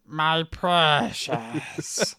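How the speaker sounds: slow, drawn-out creepy creature whisper of precious; Gollum voice. Acted. Friend answering a Gollum joke, slow creature voice. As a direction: stretch it out like Gollum.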